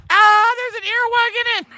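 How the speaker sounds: high shocked shriek at a bug; startled shriek. Real, not acted. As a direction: jump and shriek in real fright. Startled by earwig in flower.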